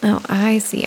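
ordinary delivery

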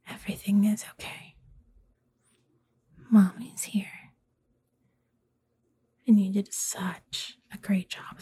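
soothing whisper. Acted. Aftercare soothing after intense scene.

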